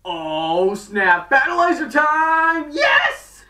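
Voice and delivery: high, loud, slowed fanboy shout; excited shout. Retold or imitated. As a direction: cheer it out like a thrilled fan. Fan excited about new armor.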